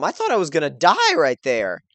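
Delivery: ordinary delivery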